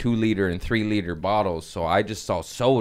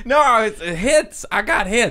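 high mock [name] voice; 12 semitones higher, 5 dB louder than usual. Comedian mocking [name]'s court defense in a high put-on voice. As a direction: pitch way up, a hammed-up pop star pleading.

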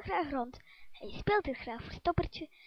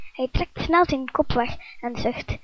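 ordinary delivery, nothing marked

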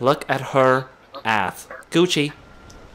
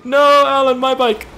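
mock wail; 10 semitones higher, 5 dB louder than usual. Bike wrecked, mock wailing.

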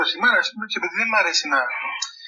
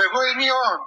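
mock solemn, raised pitch; imperious proclamation; 4 semitones higher than usual. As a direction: imitate a pompous claim to be God. Repeats the divine claim after laughter. (πομπώδης διακήρυξη, μιμητικά)

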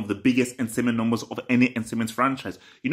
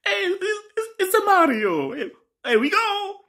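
high, squeaky Italian plumber voice with a laugh; Mario impression. Ranting fan mimicking Mario's famous voice, high and bouncy. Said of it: "He has a very distinct voice".

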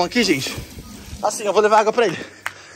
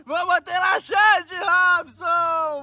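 gleeful taunting shout; 7 semitones higher, much slower than usual. Real, not acted. Pranker taunting victim from outside.

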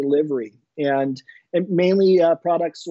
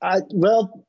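normal talking voice

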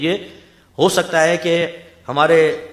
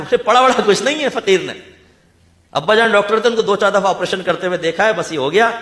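boastful quack bragging, higher and louder; mock fake doctor; 5 semitones higher, 4 dB louder than usual. Preacher mocking an untrained doctor to his audience, hammed-up boast. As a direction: play a self-taught doctor proud of watching his father.

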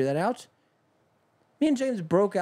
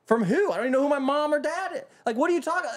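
incredulous outrage; 8 semitones higher than usual. Retold or imitated. Continues incredulous DMV customer voice.

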